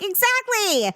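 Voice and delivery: high, emphatic mock triumph; retold exclamation. Mom answering her nervous son, emphatic exclamation.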